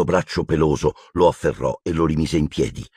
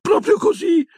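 much higher, tight voice; pitched high, frightened and prim; even shriller Umbridge; 3 dB louder than usual. Acted. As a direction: squeak it up another notch. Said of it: "con voce ancora più acuta".

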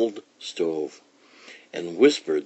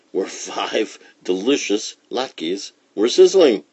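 ordinary delivery, nothing marked

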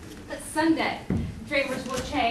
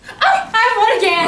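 excited win; 7 semitones higher, 11 dB louder than usual. Acted. Game win and loss.

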